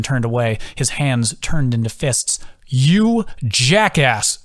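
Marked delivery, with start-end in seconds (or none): 2.7-4.4 slow, drawn-out furious yell; angry yell. A narrator's reading. Friend yells at injured Adam, furious and drawn out. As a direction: spit the insult out, dragging it. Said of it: "Carson yelled".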